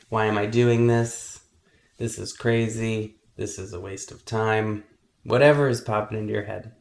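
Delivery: guided meditation. Acted. Meditation teacher helping kids focus on their breath, calm guiding voice. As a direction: guide them calmly through each breath, unhurried.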